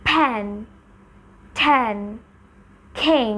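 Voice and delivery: ফ্যান ঠ্যান খিং with a puff after the first sound; aspirated ফ্যান ঠ্যান খিং; aspirated demo words. Shown as a demonstration. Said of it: "এখন যদি আমি aspirate করে বলি".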